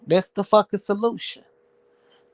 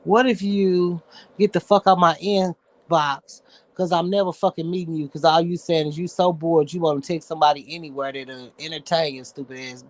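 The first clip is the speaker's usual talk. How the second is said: normal talking voice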